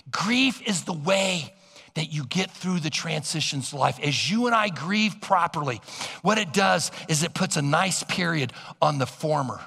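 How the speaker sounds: ordinary talk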